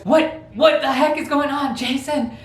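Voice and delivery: put-on older brother confronting little brother over the beanbag, outraged; mock outraged brother. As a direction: demand an answer, outraged. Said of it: "he goes".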